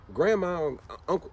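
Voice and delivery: normal talking voice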